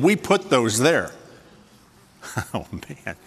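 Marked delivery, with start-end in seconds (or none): 2.4-3.2 breathy laughing aside, much softer. Real, not acted. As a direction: laugh it out quietly.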